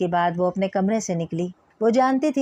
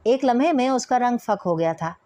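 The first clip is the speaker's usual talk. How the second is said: plain reading voice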